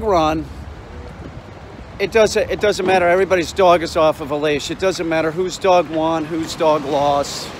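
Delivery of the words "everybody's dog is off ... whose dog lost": ordinary delivery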